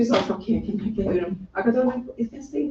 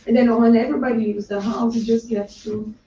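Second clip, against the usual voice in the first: normal talking voice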